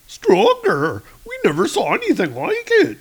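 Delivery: hammed-up stunned clown; awestruck clown. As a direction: squeak it out like a startled clown. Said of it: "the clown is there".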